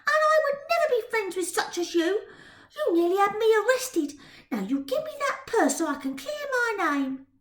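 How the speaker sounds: put-on high, sharp indignant girl voice. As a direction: bristle with offended pride. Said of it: "eyes ablaze with indignation".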